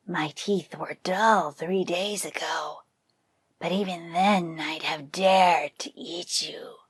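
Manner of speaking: slow, whispered threatening tiger; tiger's menacing reply. Acted. Tiger answering the buffalo's boast, slow menacing whisper. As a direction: threaten softly like a patient predator. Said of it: "the tiger replied".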